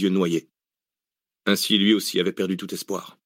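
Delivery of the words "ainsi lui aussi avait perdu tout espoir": plain reading voice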